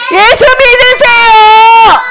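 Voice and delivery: high, drawn-out street-preacher call; ringing high proclamation, vowels stretched; evangelist's cry. Acted. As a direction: call it out slowly to a crowd. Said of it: "뭐라고 말했다고요?".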